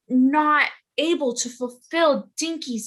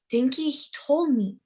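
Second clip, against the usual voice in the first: ordinary delivery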